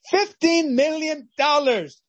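high, slow, savouring each word; awed repeat. Acted. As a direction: let the sum sink in slowly. Winner repeats the sum.

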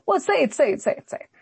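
high, scornful taunt; mocking dare. Preacher voicing Saul mocking the prophet, high taunt. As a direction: sneer it like an arrogant king. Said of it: "and he says that".

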